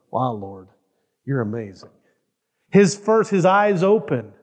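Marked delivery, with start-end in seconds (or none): 0.1-1.9 soft, low, awestruck Adam; hushed awe. Acted. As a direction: breathe it out in wonder, softer. Adam voiced waking in awe.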